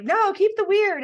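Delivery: high, loud upbeat cheer; cheering encouragement. Retold or imitated. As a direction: urge them on brightly. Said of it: "people are like".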